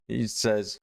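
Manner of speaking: ordinary talk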